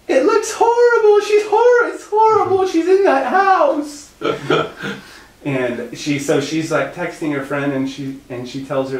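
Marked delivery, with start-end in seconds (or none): 0.1-4.1 piercing falsetto panic, very loud; mock horrified shriek. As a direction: imitate a friend wailing in horror. Panicked friend imitated in falsetto.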